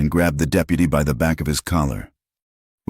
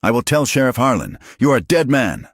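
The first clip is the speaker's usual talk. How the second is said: high, louder threat thrown back while retreating; shouted; 8 semitones higher, 3 dB louder than usual. Acted. Beaten deputy threatening the drifter, shouting. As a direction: yell it as you back away. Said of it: "his voice cracking".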